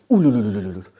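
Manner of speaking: loud, silly ululating trill; a loud mock ululation of agitators stirring people. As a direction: trill it like a rabble-rouser stirring the crowd. Mocking agitators' rabble-rousing.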